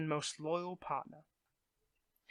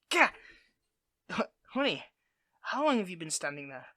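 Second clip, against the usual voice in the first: ordinary talk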